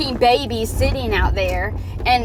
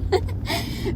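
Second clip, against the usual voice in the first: a high, soft squeal of laughter; real giggle that breaks up high; squeaky high laugh; 6 dB quieter than usual. Real, not acted. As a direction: laugh helplessly at the clip, pitched way up. Laughing at clip of woman.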